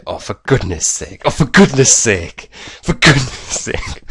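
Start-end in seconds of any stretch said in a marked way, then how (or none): 1.3-2.5 higher, louder mock exasperation; exasperated catchphrase. Imitating his own reaction when lockpicking fails, exasperated. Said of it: "something happens and you say". 2.8-4.0 high, slow mock exasperation, tipping into laughter; exasperated catchphrase. Replaying his lockpicking-fail catchphrase, exasperated and amused. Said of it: "something happens and you say".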